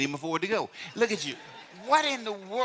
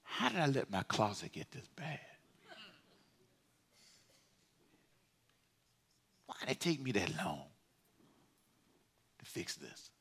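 much softer, lower confession, fading to a breath; humble prayer; 5 semitones lower, 13 dB quieter than usual. Retold or imitated. Pastor modelling a humbled prayer to the congregation, soft. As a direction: pray it face down, ashamed. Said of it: "fall on our face and say".

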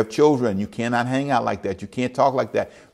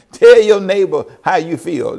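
a louder, much higher church call; mock cheery preacher; 8 semitones higher, 9 dB louder than usual. Pastor imitates old church greeting to congregation, loud mock call. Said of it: "sitting that close to them saying".

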